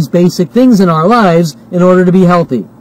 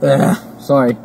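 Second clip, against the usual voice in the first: burping; 5 semitones lower, 6 dB quieter than usual. Real, not acted. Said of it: "hiccuping and burping".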